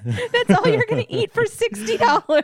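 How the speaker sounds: high, loud, laughing incredulity; laughing disbelief. Real, not acted. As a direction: squeal it through real laughter. Incredulous teasing over zebra domes.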